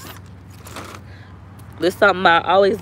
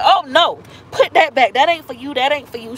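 higher, mildly alarmed mock mom; mother objecting; 5 semitones higher than usual. Mother telling her daughter to put the pink book back, objecting. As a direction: imitate a mother quickly stopping her child.

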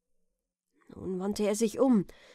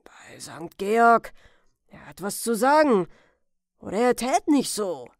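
put-on loud, emphatic old man; old man's exclamation; 8 dB louder than usual. A narrator's reading. Old man exclaiming that the boy has news, loud call. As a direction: call it out in astonishment. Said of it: "rief der Alte".

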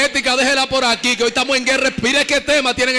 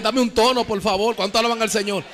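normal talking voice

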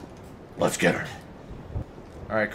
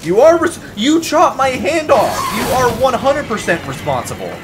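mock outrage; 9 semitones higher, 4 dB louder than usual. Scolds game character mock-outraged.

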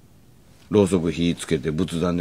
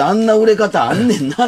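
high, loud; 7 dB louder than usual. Retold or imitated. Quoting own remark.

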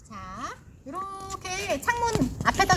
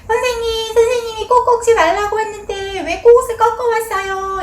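put-on higher, louder, like a protesting child; child's complaint; 7 semitones higher, 5 dB louder than usual. Teacher imitating a child who asks why flowers were picked, a bit louder and higher. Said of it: "우리 아이들은 그렇게 말해요".

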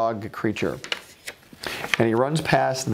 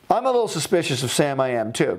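ordinary delivery, nothing marked

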